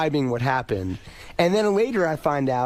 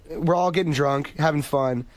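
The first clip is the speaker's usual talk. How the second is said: normal talking voice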